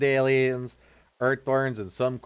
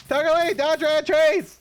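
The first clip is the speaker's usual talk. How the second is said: frantic shouting. Real, not acted. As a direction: dodge and yell. Frantic gaming yells.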